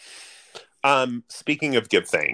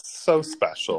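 sarcastic; 6 semitones higher, slower than usual. Acted. Sarcastic remark on Mormons.

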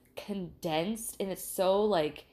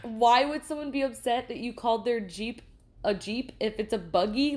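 ordinary talk

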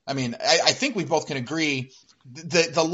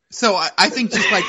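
laughing; 7 semitones higher, 7 dB louder than usual. Real, not acted. Laughing mid-question.

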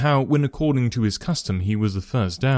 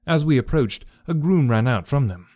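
usual speaking voice

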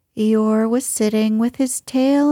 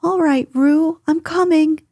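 higher-pitched call ahead; raised, urgent calling voice; 6 semitones higher, 3 dB louder than usual. A narrator's reading. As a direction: call out reassurance to someone in trouble. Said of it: "were calling out to the others".

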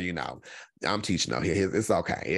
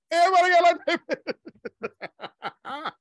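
laughing; 9 semitones higher, 5 dB louder, slower than usual. Real, not acted. Laughing repeat.

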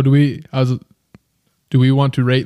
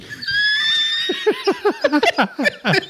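long, very high laughing fit; hysterical laughter. Real, not acted. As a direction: totally lose it laughing. Cracking up at own accent.

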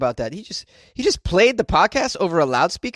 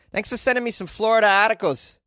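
ordinary delivery

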